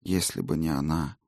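faint, airy ghost whisper; a weary spirit, barely there; ghostly whisper. Acted. Ghost reports.

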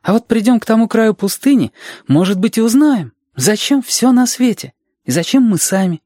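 put-on high, keen child voice; hopeful Reshka. Reshka imagining the desert's far edge, hopeful child voice. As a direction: dream aloud in a child's high pitch.

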